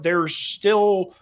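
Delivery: normal talking voice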